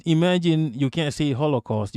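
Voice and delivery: usual speaking voice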